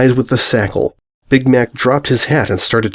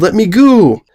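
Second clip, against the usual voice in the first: high, louder tearful cry to be let go; crying yell; 8 semitones higher, 4 dB louder than usual. A narrator's reading. Rainbow yelling while held, crying yell. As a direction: yell it out, pleading. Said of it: "Rainbow cried and yelled".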